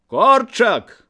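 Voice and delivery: high, loud call by surname; crying out. A narrator's reading. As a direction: shout to stop him. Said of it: "крыкнуў Мусатаў".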